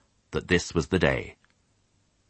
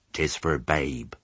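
sheep voice; 2 semitones lower, 4 dB quieter, slower than usual. Acted. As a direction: murmur it like an old sheep.

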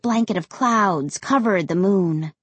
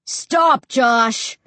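raised, forceful order; commanding call; 3 semitones higher, 4 dB louder than usual. A narrator's reading. Ray to Josh jogging ahead, commanding. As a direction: order them to halt from a distance. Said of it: "It sounded more like an order than a request".

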